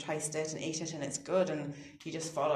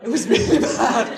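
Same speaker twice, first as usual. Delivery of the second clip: loud laughing confession, higher; 6 semitones higher, 15 dB louder than usual. Real, not acted. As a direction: laugh through it, embarrassed. Laughing about swearing after injury.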